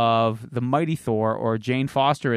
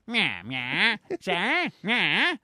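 hammed-up Italian accent, swooping higher at the end; mock Italian; 6 semitones higher, much slower than usual. Joking "or I'm Italian" to co-host, mock Italian accent. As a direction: turn the meows into joke Italian. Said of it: "Or I'm Italian".

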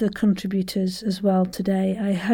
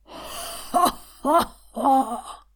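slow, separated laugh syllables, pitched up; ha-ha-ha exercise; 4 semitones higher, much slower than usual. Shown as a demonstration. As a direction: pump each ha from the diaphragm. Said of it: "ha ha ha's".

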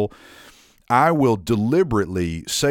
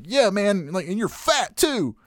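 a higher, lightly voiced stranger taking a jab; mock stranger's jab; 10 semitones higher, slower than usual. Stranger at the party calls him fat, mocked in a higher voice. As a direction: imitate the guy who crosses the line.

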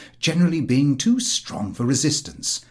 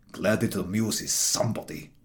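softer, dry and sour; sour reply; 4 semitones lower, 5 dB quieter than usual. Acted. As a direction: mutter it back drily.

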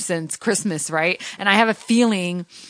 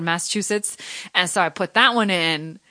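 ordinary delivery

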